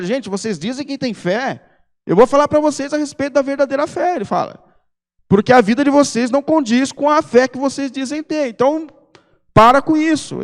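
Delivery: stern apostle rebuking his readers; hard words of Tiago. Retold or imitated. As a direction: scold the church like a stern apostle. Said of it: "ele é um escritor extremamente duro".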